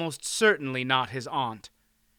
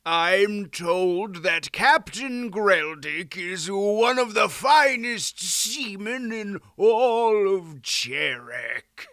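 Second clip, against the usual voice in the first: slow, raised, drawn-out lordly voice; assuring earl; 6 semitones higher, 5 dB louder, much slower than usual. A narrator's reading. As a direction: assure him in slow, stretched-out words. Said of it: "the Earl assured him".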